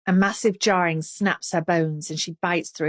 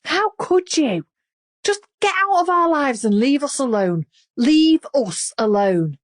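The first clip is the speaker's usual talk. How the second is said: furious scream; 8 semitones higher, 5 dB louder than usual. A narrator's reading. Melanie still screaming.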